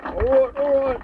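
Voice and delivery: loud call to order. Acted. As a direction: chairman shouting over the hubbub. Chair quiets a noisy room.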